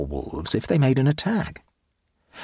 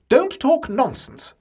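sharp scolding; 13 semitones higher, slower than usual. A narrator's reading. Aunt in the tank, scolding the boy sharply. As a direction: cut him off sternly.

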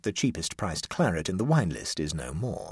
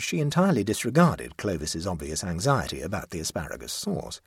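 usual speaking voice

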